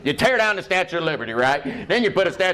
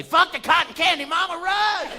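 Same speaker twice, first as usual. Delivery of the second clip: put-on high shrieking kid voice; panicked kid; 11 semitones higher than usual. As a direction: scream it high like a terrified child. Kid fleeing carnival worker.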